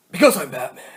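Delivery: loud, put-on superhero voice; forceful caped-crusader impression; Batman. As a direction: announce it as the masked hero. Quoting Batman as the Batman figure.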